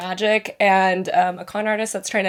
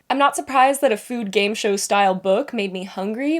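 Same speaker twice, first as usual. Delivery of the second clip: ordinary delivery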